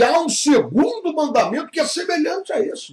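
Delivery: rising emphasis on há; stress on há. Acted. Preacher stresses the new commandment.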